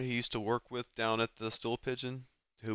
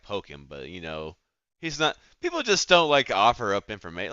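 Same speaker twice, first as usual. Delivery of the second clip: ordinary talk, nothing marked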